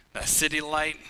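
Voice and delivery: usual speaking voice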